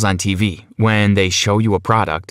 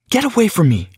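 sharp, much higher snap; snapping; 9 semitones higher than usual. Acted. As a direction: push him off with a sharp, high snap. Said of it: "she snapped at me".

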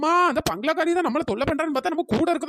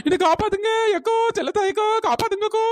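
desperate cry for help; 6 semitones higher, 4 dB louder than usual. Acted. Begging friends to save her.

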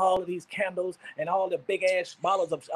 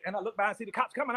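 normal talking voice

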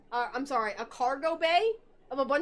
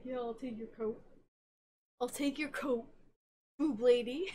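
soft, low mumbled creep voice; quietly ape the creepy coat check guy; 3 semitones lower, 6 dB quieter than usual. Retold or imitated. Voicing creepy coat check guy to friend, soft mumble. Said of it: "he's like".

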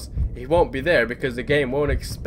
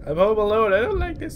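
high, loud, whimpering squeal; panicked pleading; 5 dB louder than usual. Real, not acted. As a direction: beg in fright, half laughing, half crying. Pleading after a jump scare.

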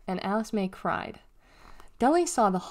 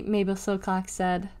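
ordinary delivery, nothing marked

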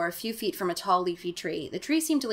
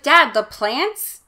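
mock Margaret; 6 semitones higher, 10 dB louder, much slower than usual. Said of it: "Margaret's just like".

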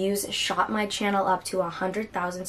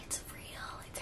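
awed breathless whisper; 23 dB quieter than usual. Real, not acted. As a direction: hush it out in disbelief.

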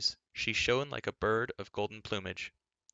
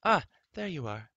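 higher, louder fluttery hail; fussy queen calling out; 7 semitones higher, 5 dB louder than usual. A narrator's reading. Queen Teleria spying Taran, lightly voiced fussy queen call. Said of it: "Queen Teleria cried".

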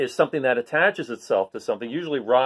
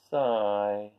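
bored sigh; 3 semitones lower, 5 dB quieter than usual. Acted. Bored barnacle sighs.

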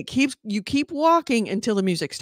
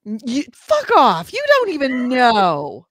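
mock outrage; 5 semitones higher, 5 dB louder than usual.

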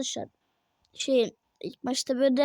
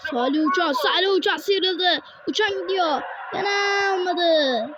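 higher, energetic play-by-play; football commentator; 7 semitones higher, 5 dB louder, slower than usual. Acted. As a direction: narrate every attack like live TV. Calls the match play-by-play.

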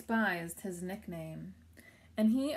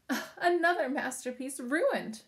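louder, higher mock panting; 6 semitones higher, 5 dB louder than usual. A narrator's reading. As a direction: gasp it out lightly, as if winded. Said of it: "Crickwing panted".

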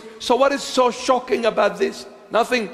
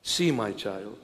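tender Jesus; 10 semitones lower, 9 dB quieter than usual. Acted. Jesus voiced again, hushed.

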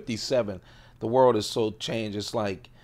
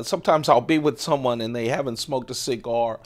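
normal talking voice

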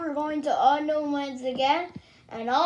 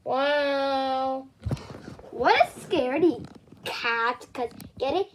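normal talking voice

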